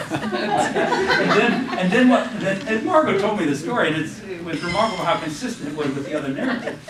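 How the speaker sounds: usual speaking voice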